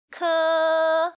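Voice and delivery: "Ke" loud, high and held level; flat high tone. Shown as a demonstration. Teacher repeating first tone, "Ke" high and flat. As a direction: sustain "Ke" on a steady high note. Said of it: "flat high".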